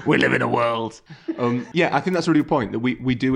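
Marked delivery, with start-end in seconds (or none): 0.0-1.0 lightly voiced gruff manager; a hint of a football manager's growl; Sean Dyche voice. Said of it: "That'd be Sean Dyche".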